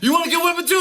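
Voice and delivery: high, loud mock of a scolding uncle; stern uncle threat. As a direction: snap a threat at the laughing kid. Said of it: "my uncle would be like".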